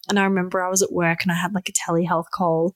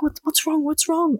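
higher, alarmed "what's wrong"; worried husband; 9 semitones higher than usual. Retold or imitated. Shane in the kitchen, alarmed, high worried questions. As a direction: ask urgently. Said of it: "Shane was literally in the kitchen like".

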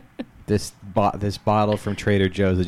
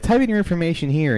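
mock official voice; 10 semitones higher, 5 dB louder than usual. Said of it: "they're like".

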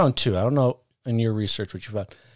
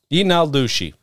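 ordinary talk

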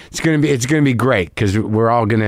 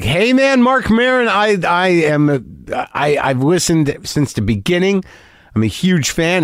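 earnest fan; 7 semitones higher than usual. Retold or imitated. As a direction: gush like a starstruck fan meeting his idol. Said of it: "Very earnest guy".

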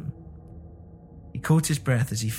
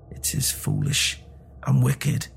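slow, halting, weakened protest; weak voice; slower than usual. A narrator's reading. Husband resisting his wife's order, weakly. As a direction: object feebly with a pause midway. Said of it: "he said weakly".

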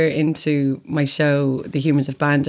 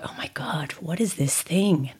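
slow, hushed inner voice; a soft, slow whisper of disbelief; whispered thought; 6 dB quieter, slower than usual. Retold or imitated. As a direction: murmur it to yourself in awe. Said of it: "and thought".

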